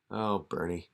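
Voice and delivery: ordinary delivery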